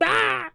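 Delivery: a sudden high yelp, imitating someone falling; mock falling yelp. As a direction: toss off a high cartoon yelp as they drop. Shoppers falling in grocery store.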